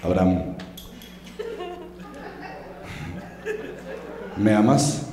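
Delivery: God's voice. Acted. God asks Abraham for his love, low and booming. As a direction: call down to him like a looming deity. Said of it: "y le dijo".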